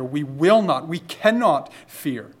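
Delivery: ordinary delivery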